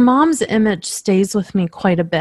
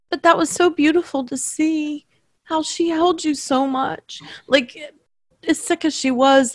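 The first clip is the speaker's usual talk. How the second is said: choked up; 6 semitones higher than usual. Real, not acted. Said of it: "I'm emotional".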